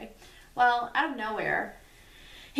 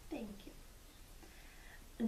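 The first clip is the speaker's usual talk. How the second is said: whisper; 16 dB quieter than usual. Real, not acted.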